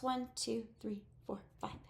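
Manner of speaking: quick hushed counting, trailing to near silence; counting to herself. Acted. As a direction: count the dots under your breath. Checking the dots to herself.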